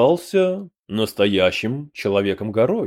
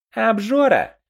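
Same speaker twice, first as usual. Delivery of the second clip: much higher goblin yelp; chiming-in goblin; 11 semitones higher, 4 dB louder than usual. A narrator's reading. Another goblin backing up the name suggestion, chiming in. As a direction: chime in eagerly in a high character voice. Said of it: "поддакнул другой".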